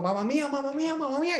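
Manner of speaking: high, panicked mock Italian cry; mock Italian yelp. Italian patient yelps at the first needle, mock panic. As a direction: squeal it like a startled Italian patient. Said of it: "ese hombre gritó y dijo".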